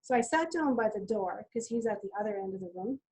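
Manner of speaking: ordinary talk, nothing marked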